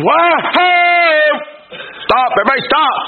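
a wagon captain's mock holler, pitch jumping up; hollered halt. Captain hollering at the wagon train to stop, in a lively mock holler. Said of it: "the captain on the wagon train hollers".

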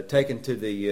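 ordinary delivery

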